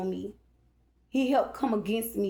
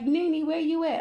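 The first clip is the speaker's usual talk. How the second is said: calling niece; 7 semitones higher than usual. Retold or imitated. Niece calling through the house for her aunt, high call. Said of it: "she was like".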